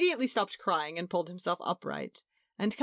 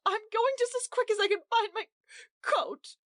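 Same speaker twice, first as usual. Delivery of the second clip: tearful. A narrator's reading. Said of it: "Blind with tears".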